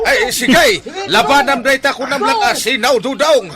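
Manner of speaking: very high, loud yelling; screaming challenge back; furious shout. Acted. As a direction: roar back at him. Driver shouting in the fight.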